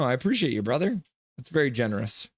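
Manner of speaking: ordinary talk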